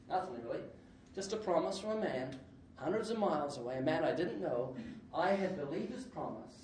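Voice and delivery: ordinary talk, nothing marked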